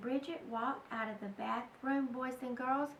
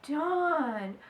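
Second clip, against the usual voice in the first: plain reading voice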